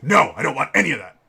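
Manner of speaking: loud, curt refusal from a gruff dad; blunt refusal. Retold or imitated. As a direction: shut the clerk down hard. Dad flatly refuses the offer.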